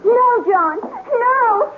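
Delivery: high, sobbing cry of no; anguished no. Acted. Wife begs her lover not to fight, anguished cry. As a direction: scream it as he draws the blade. Husband draws steel on lover.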